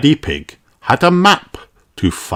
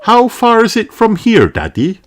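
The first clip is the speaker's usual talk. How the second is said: higher mother pig voice; Mummy Pig asking. A narrator's reading. Mummy Pig asking Daddy the distance, higher voice. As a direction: ask Daddy a little pointedly. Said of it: "asked Mummy Pig".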